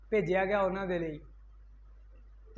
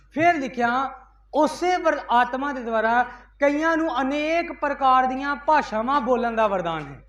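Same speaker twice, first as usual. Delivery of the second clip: loud, raised preacher's call; fiery preaching; 3 semitones higher, 6 dB louder than usual. Acted. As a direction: proclaim it to the whole church. Preaching at full force. (ਜੋਸ਼ੀਲਾ ਉੱਚਾ ਪ੍ਰਚਾਰ)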